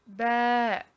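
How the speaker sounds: ba held twice as long, loud and steady; loud, drawn-out ba; long vowel. Shown as a demonstration. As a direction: stretch the a of ba to double length. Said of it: "deux fois plus long qu'une voyelle brève".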